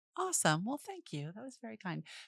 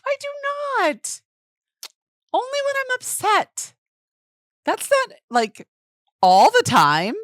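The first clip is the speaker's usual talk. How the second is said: indignant; 6 dB louder than usual. Real, not acted. Defending against yip jibe.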